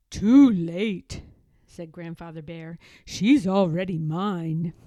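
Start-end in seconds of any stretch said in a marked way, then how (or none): 0.1-1.2 grandfather bear voice. A narrator's reading. Grandfather character voice. 3.1-4.7 grandfather bear voice. A narrator's reading. Grandfather character voice.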